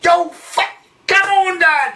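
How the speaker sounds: high shouted cheer at Dad; retold cheer. Urging Dad on in the packed pub, a high cheering shout. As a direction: yell him on like a fan. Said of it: "the old man's potted another one".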